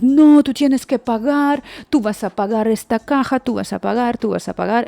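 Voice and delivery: mock scolding, pushy and repetitive; mock screaming. Shop girls surround the customer demanding payment, mock screaming. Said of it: "that they are screaming".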